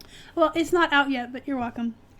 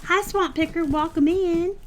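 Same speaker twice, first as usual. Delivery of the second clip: Southern twang; 4 semitones higher, 4 dB louder than usual. Pretend. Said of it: "I don't know why I'm Southern".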